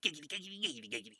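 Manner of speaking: lightly voiced sleazy catchphrase; Quagmire. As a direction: rattle it off with a cheeky leer. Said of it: "I'm Quagmire".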